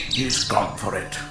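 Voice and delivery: normal talking voice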